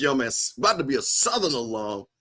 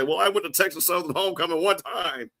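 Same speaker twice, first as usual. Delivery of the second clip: mock alum claim; 4 semitones higher than usual. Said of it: "he'll be one of those guys say".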